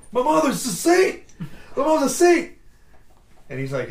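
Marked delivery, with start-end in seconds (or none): 0.1-2.5 high, loud, laughing through the quote; laughing movie quote. Retold or imitated. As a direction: crack up mid-impression.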